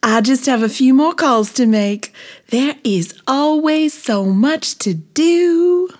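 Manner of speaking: high, loud, fussing voice; Busy Bee. A narrator's reading. Busy bee with calls to make, high loud fussing. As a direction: stretch the last words high, swamped with things to do. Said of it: "said Busy Bee".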